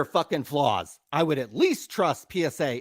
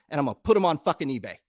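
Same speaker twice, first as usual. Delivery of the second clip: ordinary delivery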